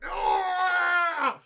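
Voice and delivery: high loud cry of dismay; real surprised yell; surprised shout. Real, not acted. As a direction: yelp at the interruption. Ad break cuts his rant.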